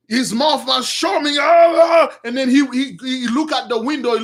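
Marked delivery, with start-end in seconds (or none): 1.4-2.1 loud, raised "aah" of a madman; mad growling yell. Acted. Saul foaming at the mouth, roaring madly. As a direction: roar it out like a crazed king.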